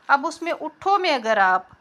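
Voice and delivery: usual speaking voice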